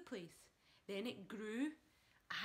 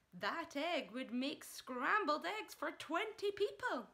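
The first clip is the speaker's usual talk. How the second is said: mother voice; 7 semitones higher, 5 dB louder, slower than usual. A narrator's reading. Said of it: "Missus Cranky said".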